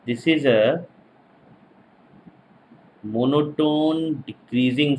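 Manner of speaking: slow, spaced-out lecture dictation. Real, not acted. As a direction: dictate phrase by phrase for note-takers. Dictating for students to write.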